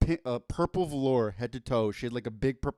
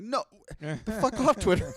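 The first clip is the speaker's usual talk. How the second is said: exasperated outburst; 9 semitones higher, 4 dB louder than usual. Retold or imitated. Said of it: "I'm like".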